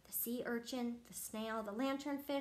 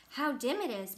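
ordinary delivery, nothing marked

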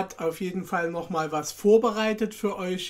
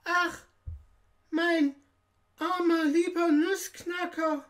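put-on much higher-pitched girl's lament; crying out in distress; 12 semitones higher than usual. A narrator's reading. Marie over her broken Nussknacker, crying out. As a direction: exclaim it in a high little-girl voice, word by word. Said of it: "schrie Marie laut".

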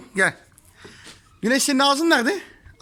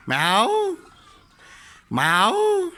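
long swooping meow, higher at the end; cat meow imitation; 3 semitones higher than usual. Shown as a demonstration. Coaxing little Güneş to copy a cat, imitated meow. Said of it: "Kedi nasıl bağırıyor kedi?".

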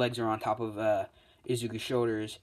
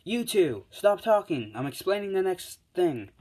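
loud, high-pitched scolding interruption; Midnight snapping; 8 semitones higher, 6 dB louder than usual. Retold or imitated. Midnight interrupting two chatting students, raised snappy voice. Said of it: "Midnight snaps them out of it".

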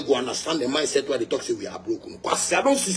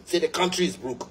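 ordinary talk, nothing marked

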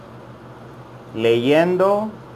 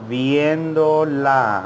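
"Viéndola" drawn out slowly, each syllable long; much slower than usual. Shown as a demonstration. Teacher slowing down for students to repeat, very slowly. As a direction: stretch it out for learners to copy. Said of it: "I'm gonna say it very slowly".

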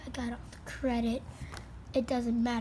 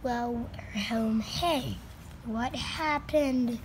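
slow puzzled; slower than usual. Acted. Slow bewildered arrival.

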